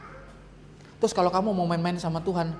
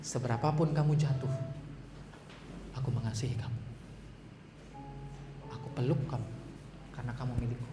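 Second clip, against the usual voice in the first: softer, near-whispered embrace; God's tender whisper; 6 semitones lower, 9 dB quieter than usual. Acted. God embracing a fallen child, whispered tenderly. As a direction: breathe the promise like a hug. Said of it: "dia bilang begini".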